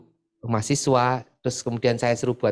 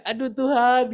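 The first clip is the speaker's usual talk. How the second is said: embarrassed plea; 13 semitones higher than usual. Retold or imitated. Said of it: "Wah, itu saya, saya".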